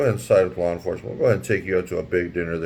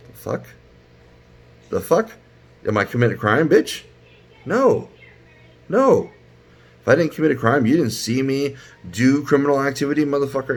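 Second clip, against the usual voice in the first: acted indignation; 6 semitones higher than usual. Acts out indignant reply to cop.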